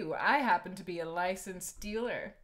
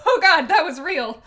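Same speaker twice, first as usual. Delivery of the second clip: laughing loudly; 7 semitones higher, 15 dB louder than usual. Real, not acted. Streamer cracks up at poem.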